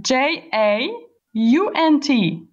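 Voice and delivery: letters spelled out slowly; slow spelling. Shown as a demonstration. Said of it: "spelling the word out".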